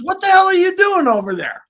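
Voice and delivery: retold buddy hollering; buddy yelling. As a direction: call across to him, startled. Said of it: "My buddy says".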